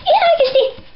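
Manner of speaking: very high astonished squeak; can't believe it, squeal it high; amazed gasp. Acted. Amazed reply. (hämmästynyt kiljaisu)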